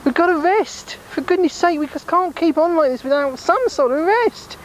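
high small voice pushing others on; high-pitched squeaky voice. Acted. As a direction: squeak at them to keep moving. High voice pleading for rest.

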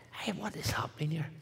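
hushed, awed whisper; a stunned whisper at the miracle. Retold or imitated. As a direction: breathe it out in disbelief. Stunned by the healing.

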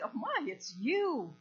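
slow, swooping cry of surprise; retold gushing reunion greeting; delighted recognition. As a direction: stretch it out in amazed delight. Retold delighted recognition, answering her.

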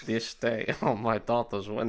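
softly botched, Indian-sounding mobster; quiet attempt at a don drifting into an Indian accent; failed Godfather accent, sounding Indian. Co-host resuming the line after laughing, soft botched accent sounding Indian. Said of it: "That sounded Indian".

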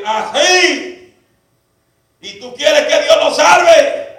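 high, drawn-out preacher's question; rousing challenge. Retold or imitated. Preacher challenging a father about his son, rousing cry. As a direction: call the question out slowly.

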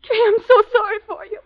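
high, crying lament; tearful dread. Acted. Edna sorry for Kay after the grave dance, tearful. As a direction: sob it out in horrified pity. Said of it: "stop talking like that".

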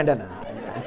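normal talking voice

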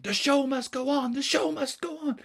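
high, urgent stage-director voice; insistent director. Acted. Director tries to save the show, high insistent plea. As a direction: keep repeating it in a high, anxious call. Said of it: "the director kept saying".

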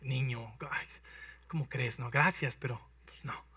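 a hint of a weary king; dismissive king. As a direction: wave the boy away gently. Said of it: "dice el rey Saúl".

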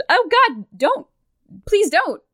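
high-pitched, hammed-up frightened begging; mock recoiling plea. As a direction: plead as if shrinking from a blow. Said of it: "So she recoils".

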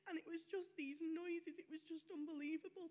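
ordinary talk

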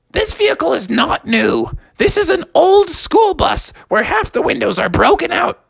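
high, mocking retort; sassy kid. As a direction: correct your dad cheekily. Bubby talks back.

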